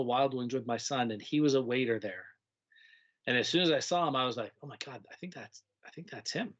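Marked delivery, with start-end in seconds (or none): 4.6-6.5 hushed surprise. Retold or imitated. Spotting former student in restaurant.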